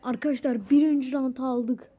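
loud, high cheer of triumph; real burst of gamer joy; victory shout. Real, not acted. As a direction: yell it out like you just won. Winning the first boss round.

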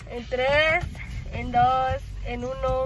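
muffled through fingers; speaking behind palm; hand over mouth. Real, not acted. Seen 3: Hand covers mouth from 2.9 s; before, fingers only beside face.

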